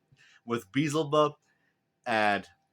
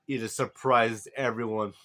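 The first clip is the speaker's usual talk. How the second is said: ordinary delivery, nothing marked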